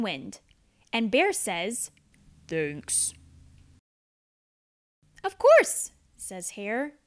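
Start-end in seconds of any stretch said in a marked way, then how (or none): 2.5-3.1 low, soft gentle bear; a big shy bear mumbling thanks. Acted. Bear thanking Mouse for the pie, low and soft. Bear's voice. 5.2-5.9 high, loud bouncy hare; perky hare. Acted. Hare answering Bear, high and perky. Hare's voice.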